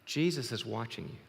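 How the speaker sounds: a hint of an eerie voice, low and soft; lightly voiced mystery voice, low, hushed; mysterious voice. Joke teller as unseen voice warning a burglar, mildly mysterious. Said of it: "the voice says, in the deep, dark silence".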